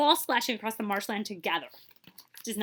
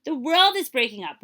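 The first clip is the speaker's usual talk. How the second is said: panicked warning; 6 semitones higher, 8 dB louder than usual. Acted. Tigers warn the rhino.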